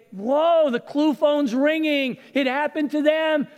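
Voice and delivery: wake-up call exclamation; excited realization. Pretend. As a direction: call it out like you just got it. Preacher voices a realization.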